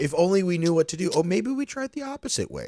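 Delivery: fast, high-pitched clueless guest; mock cluelessness. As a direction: feign a sudden bright idea, pitch climbing. Mocking clueless Airbnb guests' reasoning.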